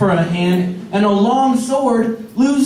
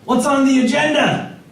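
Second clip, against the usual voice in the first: much higher, slow and keen, like a rookie on day one; mock eager pirate recruit; eager new pirate; 7 semitones higher than usual. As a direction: pitch it up, a bright eager greenhorn asking about the plan. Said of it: "he gets all excited to be a pirate".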